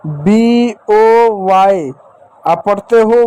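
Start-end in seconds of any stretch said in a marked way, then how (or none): 0.1-1.9 letter names drawn out slowly, बी ओ वाई; teacher spelling letters slowly; spelled out slowly. Shown as a demonstration. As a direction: spell बी ओ वाई one slow letter at a time. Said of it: "जैसे आपको बचपन से पढ़ाया गया है".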